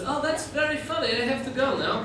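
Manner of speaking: high-pitched Ukrainian-accented impression; thick mock Ukrainian accent; strong Ukrainian accent. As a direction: mimic a Ukrainian woman making her excuses. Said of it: "you've got a strong accent".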